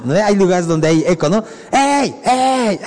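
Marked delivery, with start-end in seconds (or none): 1.7-2.8 high call echoing off the mountains. Retold or imitated. Preacher imitates God's voice echoing from the mountains, high call. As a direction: call out like a voice bouncing off the hills. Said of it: "Así era la voz".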